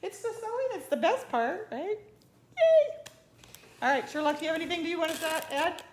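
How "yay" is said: high, loud burst of joy; excited cheer. Real, not acted. Sewer cheering for the sewing part, high and loud. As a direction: squeal it out with glee.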